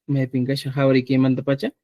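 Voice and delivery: ordinary delivery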